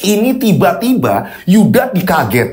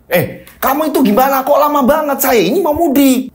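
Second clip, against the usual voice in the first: higher, impatient demanding customer; insistent complaint; 8 semitones higher than usual. Acted. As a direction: push him, fed up with waiting. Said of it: "Pak Iqbal ini ngotot". (pelanggan ngotot, nada tinggi)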